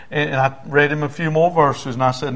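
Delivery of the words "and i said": ordinary delivery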